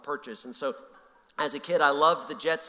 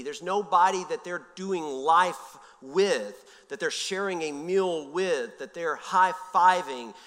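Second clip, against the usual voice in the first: slow, weighty, each phrase leaned on; slow down to let it land; slow emphasis; 3 semitones higher, 3 dB louder, slower than usual. Acted. Preacher to congregation on loneliness, slow and weighty. Pastor stressing lonely people's plight.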